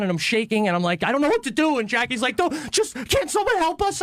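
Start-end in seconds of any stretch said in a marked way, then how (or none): 1.0-1.8 high-pitched acted freak-out; retold panic. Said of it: "you're just freaking out". 2.4-4.0 very high-pitched mock plea for help; panicked wife impression. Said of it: "you're just freaking out".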